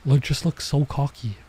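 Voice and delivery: usual speaking voice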